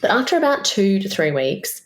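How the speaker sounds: ordinary talk, nothing marked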